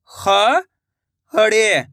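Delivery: खड़े drawn out slowly, syllable by syllable; slow syllable split. Shown as a demonstration. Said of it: "मैं किस तरीके से".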